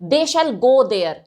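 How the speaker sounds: slow, deliberate They shall go there with shall pressed; determined, slow statement; stressed shall. Shown as a demonstration. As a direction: drag out "shall" with weight. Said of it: "determination को show करता है".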